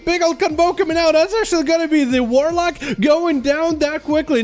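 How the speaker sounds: high, excited live commentary; play-by-play. Acted. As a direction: call the fight as it happens.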